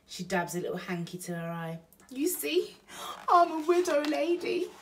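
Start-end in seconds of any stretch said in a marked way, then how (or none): 2.1-4.7 high, loud, mock-mournful; Betty tells Bill she is a widow, hammed-up; Burglar Betty. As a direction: play up the widow.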